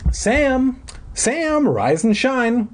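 very high sing-out wake-up call; mother calling upstairs. A narrator's reading. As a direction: call up to a sleeping child, high and drawn out. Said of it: "My mother's voice floated up to my room from downstairs".

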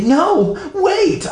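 very high-pitched desperate plea; high, stretched-out objection; urgent protest. A narrator's reading. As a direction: protest in acted panic. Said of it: "I protested".

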